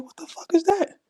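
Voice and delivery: high-pitched incredulous question; baffled amazement. Retold or imitated. Comic reacting to hearing of Swarovski crystals, high baffled amazement. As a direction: pitch it way up in disbelief.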